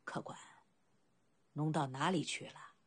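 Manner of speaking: put-on low, pondering old boatman. A narrator's reading. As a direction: begin very softly, as if weighing it up. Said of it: "沉吟道". (低声沉吟的老人口吻)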